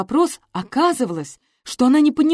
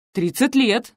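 proud; 4 semitones higher, slower than usual. A narrator's reading. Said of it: "с гордостью сказала она".

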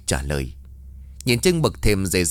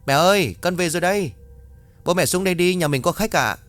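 calling upstairs; 8 semitones higher than usual. Acted. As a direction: call up the stairs to her parents. Girl calls up to mother.

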